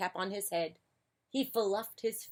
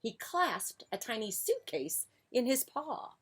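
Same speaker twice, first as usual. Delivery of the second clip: plain reading voice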